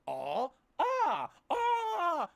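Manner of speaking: two high loud yelps with a fake cry; mock crying yelps. Mocking the Ace Ventura scene, high crying yelps. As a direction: cry out hammily at the second arrow. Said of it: "He goes".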